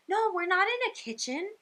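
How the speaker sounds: put-on high-pitched mom voice, correcting a child; imitated mom. Mom correcting her little girl, high imitated voice. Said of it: "And the mom turns and looks at her and she's like".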